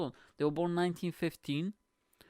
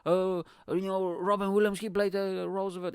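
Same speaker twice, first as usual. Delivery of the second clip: high, gushing fan voice; mock fan gushing about the film; excited fans; 7 semitones higher than usual. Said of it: "everybody's like".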